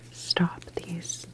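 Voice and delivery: ordinary talk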